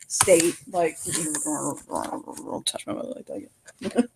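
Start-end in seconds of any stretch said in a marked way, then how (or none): 1.4-4.1 whispered threat. Acted. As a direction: hiss the warning as you fade away. Goddess retreating, muttering threat.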